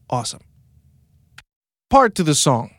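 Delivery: ordinary talk, nothing marked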